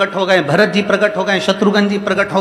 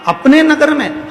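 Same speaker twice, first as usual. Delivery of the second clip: high, louder insistent confirmation; emphatic confirmation; 8 semitones higher, 4 dB louder than usual. Acted. Woman confirms to the king, high and emphatic. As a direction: stress अपने sharply.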